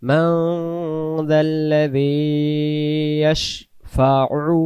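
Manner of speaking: slow chanted recitation, long drawn vowels; Quran recitation. Shown as a demonstration. Teacher reciting Ayatul Kursi to students, slow chant. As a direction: chant each word slowly and melodiously.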